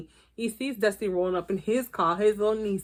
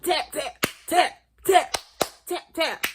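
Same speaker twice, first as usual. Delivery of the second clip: mock wrestler tapping; 4 semitones higher, slower than usual. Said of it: "You was like".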